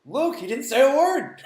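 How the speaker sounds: high, loud, slow put-on voice; silly voice. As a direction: ham it up in a silly voice. Put-on voice in chat.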